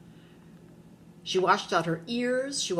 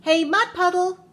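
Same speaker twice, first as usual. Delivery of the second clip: big high, loud shout; very loud, high call-out; yelling even louder; 11 semitones higher, 8 dB louder than usual. A narrator's reading. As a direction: holler it with everything you have. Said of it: "she yelled even louder".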